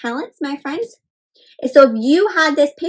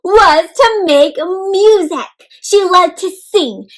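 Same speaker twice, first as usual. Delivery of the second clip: dramatic read; 6 semitones higher, 8 dB louder, slower than usual. Acted. Dramatic storybook reading.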